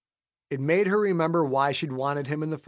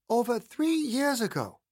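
put-on a childlike higher Jean; Jean's voice; 9 semitones higher than usual. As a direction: voice Jean sweetly and knowingly. Jean finishing his sentence.

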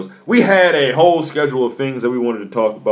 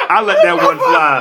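laughing; 4 dB louder than usual. Real, not acted. Laughing admission.

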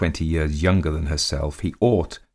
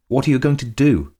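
high, louder mocking question; quizzical; 8 semitones higher, 6 dB louder than usual. A narrator's reading. Countess asking her ruined husband, quizzically. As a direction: ask it with a sly quizzical lilt. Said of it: "with a quizzical glance".

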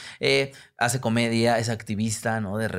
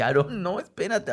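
higher, urgent pleading; backing off from a brother who is now bigger; mock alarmed plea; 8 semitones higher than usual. Begging his teenage brother to wait as he comes at him, urgent and higher. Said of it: "Le dije".

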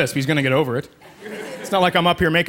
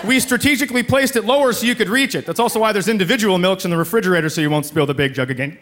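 usual speaking voice

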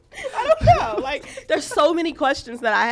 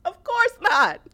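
sarcastic high whine; 9 semitones higher, slower than usual. Real, not acted. Sarcastic aside.

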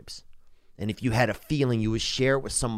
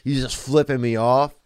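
ordinary talk

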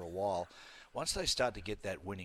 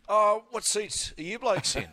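mock innocent question; 10 semitones higher, 6 dB louder, slower than usual. Retelling his airport question.

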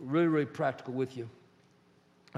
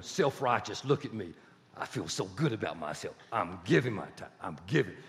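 lower, smug mock self-praise; self-righteous boasting; 7 semitones lower than usual. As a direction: preen like a proud giver. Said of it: "you're going".

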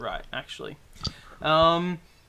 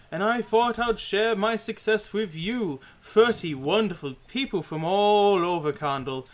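high, booming showman host; booming sing song welcome; 5 semitones higher, 4 dB louder than usual. Acted. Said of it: "and he goes".

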